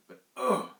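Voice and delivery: loud, high revolted cry; disgusted ugh. Retold or imitated. Thomas recalling touching the watchman's head, revolted cry. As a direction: recoil from something cold and mushy.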